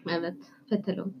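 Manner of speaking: "fetelon" with the f and t swapped; mispronounced telephone. Retold or imitated. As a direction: say "fetelon" like Ron mangling telephone. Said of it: "Telefon yani fetelon diyordu".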